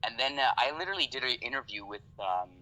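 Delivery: usual speaking voice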